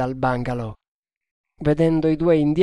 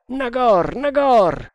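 high, loud call for Nagor; shouting; 10 semitones higher, 6 dB louder, slower than usual. A narrator's reading. Tremal Naik calls out for his missing companion, shouting. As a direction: cry out the name, pitched high and loud.

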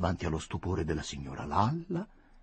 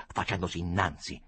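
ordinary delivery

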